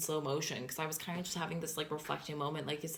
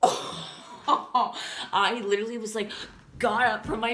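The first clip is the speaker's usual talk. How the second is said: laughing gasp; 4 semitones higher, 8 dB louder, slower than usual. Real, not acted. Gasping, laughing at the memory.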